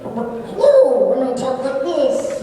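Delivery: high, loud cartoon creature voice; chicken monster. Voice actor trying out a voice for a game monster, high chicken monster. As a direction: squawk it like a giant baby-chicken monster. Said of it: "that was the voice I chose".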